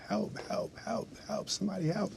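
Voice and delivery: hushed, half-whispered mock scream for help; retold screaming. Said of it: "I start screaming louder and louder".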